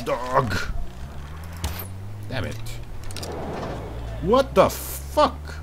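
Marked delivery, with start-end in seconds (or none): none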